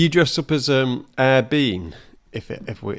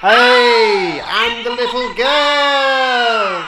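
high, pitched-up chipmunk voice through the mic; little girl voice effect; 11 semitones higher, 6 dB louder, much slower than usual. Shown as a demonstration. Showing the mic's little girl voice effect to a friend. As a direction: squeal it like a tiny girl. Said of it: "sound like a little girl".

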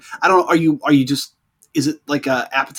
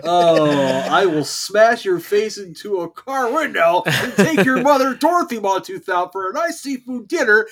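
barbarian; 6 semitones higher than usual. Pretend. Mock movie threat quote.

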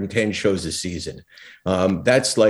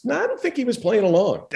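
much higher, comic voice of doubt; high-pitched mock caution; imitated producer; 8 semitones higher, faster than usual. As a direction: pitch it way up for a hesitant correction. Producer's worried verdict, retold for laughs.

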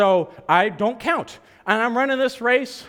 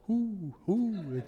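soft, drawn-out owl hooting; hollow hooting like an owl; 10 dB quieter than usual. Retold or imitated. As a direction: imitate a distant owl, quietly. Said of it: "you can hear an owl just go".

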